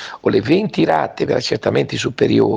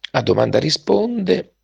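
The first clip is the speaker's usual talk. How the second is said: hoarse from a cold; 7 semitones higher than usual. Real, not acted. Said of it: "scusate la voce stamattina, sono un po' raffreddato".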